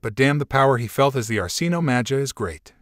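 ordinary delivery, nothing marked

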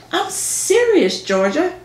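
high, slow, drawn-out insistence; insistent. Acted. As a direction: stretch the word serious, pleading. Repeating after Ginger laughs it off.

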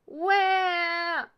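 put-on high, loud wail of a woken baby; long baby yell; baby's wail. A narrator's reading. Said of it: "woke up with all this noise and yelled".

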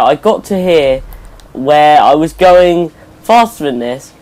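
ordinary delivery

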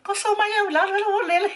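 very high, laughing squeal; giddy shriek. Retold or imitated. High on the roof with her cousin, shrieking with giddy laughter. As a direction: squeal in delighted panic. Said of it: "I was like".

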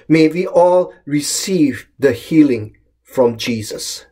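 usual speaking voice

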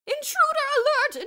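very high, very loud PA announcement; loudspeaker alarm. A narrator's reading. Miss Scrimmage alarms the school over the loudspeaker. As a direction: blare it over the speakers. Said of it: "voice came over the public address system".

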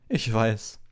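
high, loud, smug agreement; Lockhart voice. A narrator's reading. Lockhart smugly agrees with Harry, high and loud. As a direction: take it as praise for yourself. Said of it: "sagte Lockhart".

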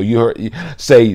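ordinary talk

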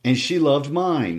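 high, slow spiteful taunt. Acted. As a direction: savor the cruel jab.

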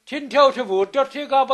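loud, scornful rebuke; scolding. Retold or imitated. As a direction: tell him off sharply. Peg scolding fairy in story.

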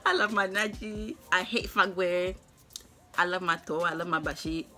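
ordinary talk, nothing marked

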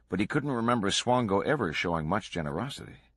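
usual speaking voice

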